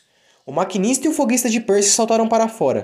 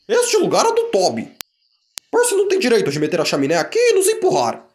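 vagões resmungando. Acted. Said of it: "resmungavam uns para os outros".

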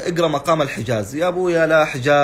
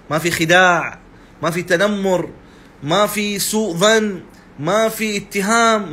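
higher and louder with each phrase, hammering every point; rising sermon fervour; 6 semitones higher, 4 dB louder than usual. Acted. Preacher driving the point home to his followers, fervent and loud. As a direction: build a preacher's fervent list, each line a hammer blow.

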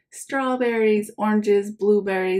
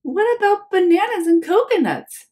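high, louder mother voice; cheery mom; 11 semitones higher, 5 dB louder than usual. Acted. Mom teasing Oliver about bananas, high and bright. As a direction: tease him with a bright question.

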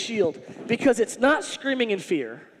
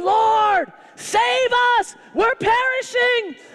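high, loud desperate cry for help, slowed on the last word; panicked plea; 11 semitones higher, 4 dB louder, much slower than usual. Retold or imitated. Terrified disciples crying out to Jesus in the storm, loud high panic. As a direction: beg to be saved as the boat sinks.

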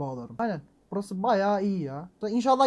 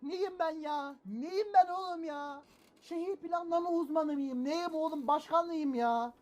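higher, softer self-mocking complaint; exasperated whine; 7 semitones higher, 7 dB quieter, slower than usual. Real, not acted. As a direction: whine at yourself, half-laughing. Frustrado con la planificación urbana.

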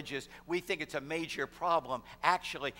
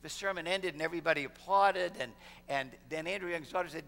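normal talking voice

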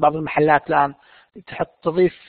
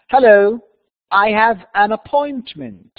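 slow, higher, clearly pronounced; careful model reading; 4 semitones higher, 5 dB louder, much slower than usual. Shown as a demonstration. Teacher reading to students so they hear how words are pronounced, slow careful reading. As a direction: read it out slowly for learners. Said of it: "listen carefully and see how words are pronounced".

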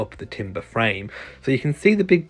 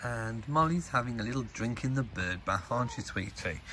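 soft pet talk; 2 semitones lower, 12 dB quieter than usual. Real, not acted. Talking softly to the dog.

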